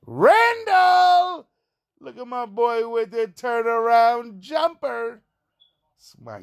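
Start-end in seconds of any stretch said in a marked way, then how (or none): 0.1-1.5 shouted name. Real, not acted. Fan cheering his favorite Knicks player, loud high shout. 2.0-5.2 hyped. Real, not acted. Hyping the player's shot.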